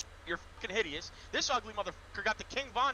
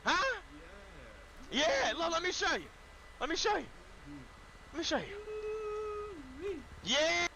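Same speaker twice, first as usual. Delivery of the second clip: hyped taunting; 7 semitones higher, 16 dB louder, much slower than usual. Acted. Magic trick taunting.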